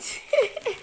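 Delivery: high delighted giggle; a quick squeal of laughter; happy little laugh. Real, not acted. Delighted over gifts.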